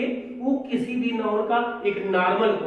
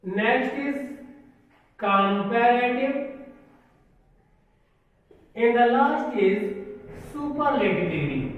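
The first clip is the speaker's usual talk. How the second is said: slow dictation; much slower than usual. Acted. Slow listing of degrees.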